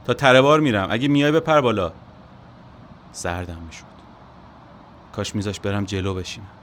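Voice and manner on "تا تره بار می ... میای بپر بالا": loud call over the engine; shouting from window. Acted. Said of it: "از پنجره داد زد".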